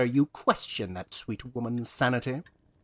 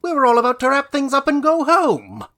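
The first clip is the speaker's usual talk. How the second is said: raised, higher, louder protest; indignant outburst; 9 semitones higher, 6 dB louder than usual. Acted. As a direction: blurt it out, fed up. Indignant actor interrupted mid-wrap.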